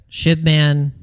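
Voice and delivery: ordinary talk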